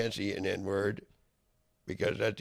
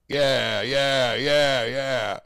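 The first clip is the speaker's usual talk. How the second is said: louder, punched angry yeah, yeah, yeah; 5 dB louder, much slower than usual. Real, not acted. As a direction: bark each yeah at them. Angry rant, repeated shouted yeahs.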